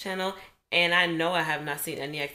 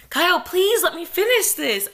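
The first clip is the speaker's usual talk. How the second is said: high, loud exasperated plea; 10 semitones higher, 5 dB louder than usual. Real, not acted. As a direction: beg him to stop, fed up. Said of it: "the aggravation".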